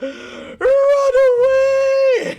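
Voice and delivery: high shrieking yells of fire, stretched out; panicked fire scream. Acted. Voicing the panicked ghost, shrieking at the fire. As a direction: scream like a terrified ghost seeing flames.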